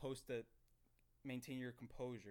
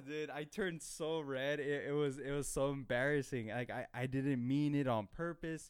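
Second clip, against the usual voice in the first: flustered; 3 semitones higher, 8 dB louder than usual. Real, not acted. Confessing to viewers how red he turned, flustered and loud. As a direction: say it like you're still blushing.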